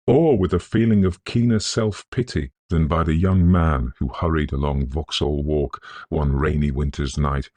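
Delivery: ordinary delivery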